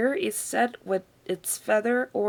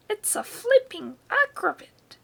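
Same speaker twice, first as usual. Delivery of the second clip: put-on higher, gleeful old woman's shout; grandma shouting delighted; 12 semitones higher, 3 dB louder than usual. A narrator's reading. Grandma on the roof delighted by the hen, gleeful shout. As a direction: crow with delight from above. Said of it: "Grandma shouted".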